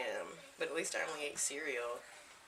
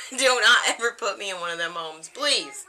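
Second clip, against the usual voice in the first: loud insistent plea; 4 semitones higher, 12 dB louder than usual. Real, not acted. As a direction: stress on "not", much louder with a laughing edge. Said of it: "You hear me?".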